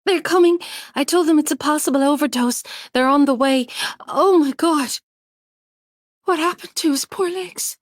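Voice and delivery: breathless alarm; panicked. Acted. Panicked friend on phone.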